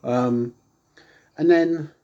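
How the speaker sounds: normal talking voice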